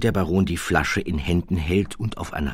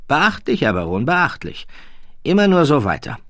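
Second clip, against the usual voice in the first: high, nasal-free lofty aristocrat voice, much higher and louder; a condescending royal drawling his words; haughty prince; 9 semitones higher, 3 dB louder than usual. Acted. The prince corrects the baron, high and lofty.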